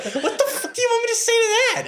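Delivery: incredulous laughing. Retold or imitated. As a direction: squeal it through a laugh, baffled. Baffled by cop's music question.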